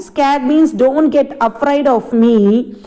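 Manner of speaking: ordinary delivery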